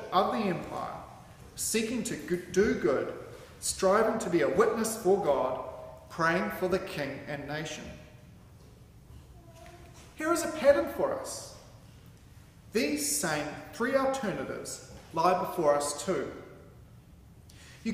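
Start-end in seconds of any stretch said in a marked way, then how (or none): none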